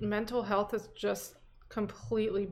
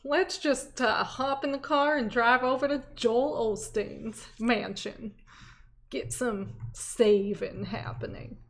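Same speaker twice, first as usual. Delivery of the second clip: hammed-up Southern accent; bad Southern drawl, slowing at end; 2 semitones higher, 3 dB louder, slower than usual. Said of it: "that was the worst Southern accent".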